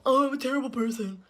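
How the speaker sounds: high, whiny imitation of self-pity; mock crying. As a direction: mimic her wailing to a friend, mockingly. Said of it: "she goes, like, crying to her friend".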